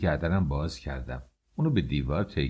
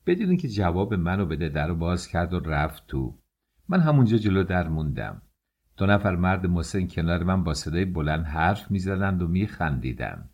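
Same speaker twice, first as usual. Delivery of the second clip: usual speaking voice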